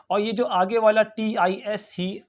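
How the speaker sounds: ordinary talk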